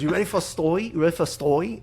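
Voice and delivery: playful Filipino priest inviting a story; Filipino accent. Retold or imitated. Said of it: "Dude, your accents".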